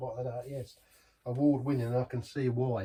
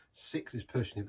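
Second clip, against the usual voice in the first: ordinary talk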